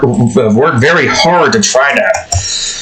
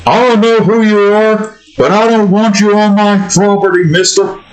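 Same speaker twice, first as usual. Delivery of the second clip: indignant cartoon homeowner, raised pitch; Hank Hill; 5 semitones higher than usual. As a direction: warn a trespasser in character. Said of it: "Mister Hill".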